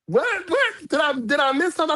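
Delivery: baffled surprise. Retold or imitated. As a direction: act totally caught off guard. Caught off guard by Barbie.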